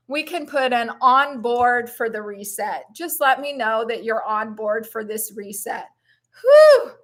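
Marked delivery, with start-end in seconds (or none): 6.4-6.9 high, loud whew of release; emotional whew. Real, not acted. Host catching herself as she gets emotional in her sign-off, loud whew. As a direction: blow off welling emotion in a high whoop. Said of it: "I'm getting emotional".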